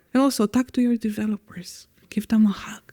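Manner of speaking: hushed, mostly whispered soothing delivery; soothing reading voice. Pretend. Podcast guest playfully comforts developers in a soothing whisper. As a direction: let the words sink into a playful soothing whisper. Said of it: "some C R O ebooks doing some readings".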